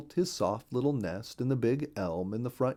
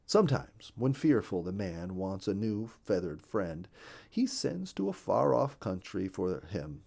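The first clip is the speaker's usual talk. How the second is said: usual speaking voice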